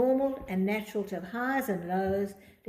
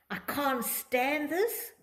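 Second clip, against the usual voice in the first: slow dramatic complaint; 5 semitones higher, much slower than usual. Shown as a demonstration. Dramatic phrase vs calm phrase.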